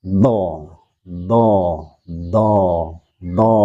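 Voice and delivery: "do" said straight out, unblocked; direct, flowing dhad. Shown as a demonstration. Teacher repeating the letter for students, direct flowing "do". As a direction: release each "do" directly and let it flow.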